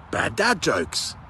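drawn-out, deadpan intro call; slow title announcement. Acted. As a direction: announce it slowly like a show title.

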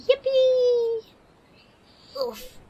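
high, loud yippee squeal; excited squeal. Real, not acted. Cooing over her dog, an excited squeal.

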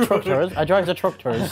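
broad West Country "tractors"; West Country accent. Shown as a demonstration. Drilling a friend on the word, West Country accent. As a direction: push the West Country burr. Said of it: "Southern England".

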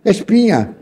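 a hint of a Colombian waiter; waiter correcting. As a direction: put on the waiter's patient correction. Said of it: "Ele diz".

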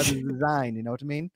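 hammed-up German accent, lightly done; mock German accent. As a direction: play a German driver in a jokey German accent. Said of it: "That's pretty good".

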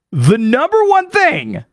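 high, drawn-out announcement; emphatic hype. Real, not acted. Podcaster hyping Dublin's top attraction, emphatic and drawn-out. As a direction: stretch THING high for emphasis.

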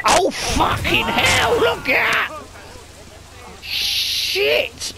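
high startled shout of disbelief; shocked shout. Real, not acted. Gamer yelling at the screen in shock. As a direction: yell it out at the screen. Shocked at game crash footage.

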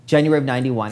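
ordinary talk

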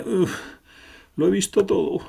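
high, exasperated sigh of a line; voiced newcomer. The new arrival mocks the group, in a high exasperated voice. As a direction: sound fed up with what you saw.

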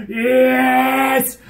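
loud, high, giddy squeal of joy; over-the-top excited cheer; excited shout. Retold or imitated. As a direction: yell it like you can't contain yourself. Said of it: "I really got excited".

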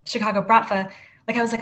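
ordinary talk

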